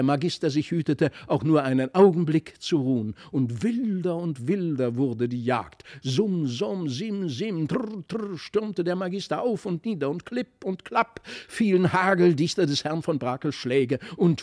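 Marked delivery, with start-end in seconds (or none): none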